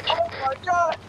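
surprised shout. Real, not acted. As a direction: gasp it out in shock. Plane suddenly flying.

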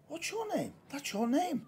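high, soft quoted line; acted stage line. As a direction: say it high and lightly, as another actor's line. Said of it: "and he goes".

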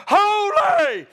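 very high, loud cry of "Holy!"; huge heavenly shout; angels crying out. Acted. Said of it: "one is crying to the other".

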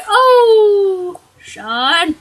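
usual speaking voice

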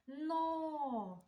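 "Но" with a long drawn-out о; sound-analysis demo. Shown as a demonstration. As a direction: hold the vowel of "Но". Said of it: "звуковий аналіз".

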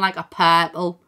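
ordinary talk

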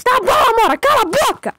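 high furious yell; angry shout. Real, not acted. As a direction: scream at her to shut up. Yells at sister interrupting again.

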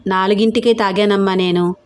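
ordinary delivery

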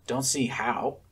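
ordinary delivery

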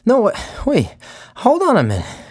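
high, loud, breathless calls; breathless from running backwards; out of breath. A narrator's reading. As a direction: gasp it out after running. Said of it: "out of breath".

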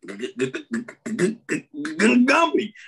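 stuttering imitation. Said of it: "He goes".

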